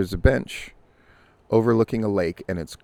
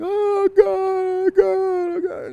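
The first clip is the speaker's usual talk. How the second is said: much higher, louder hammed-up wailing sob; fake wailing; 5 dB louder, slower than usual. Woman putting on fake wailing to catch the jogger, hammed-up high sob. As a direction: wail it like a staged cry for attention. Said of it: "puts on a wailing crying".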